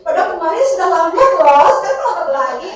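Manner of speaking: normal talking voice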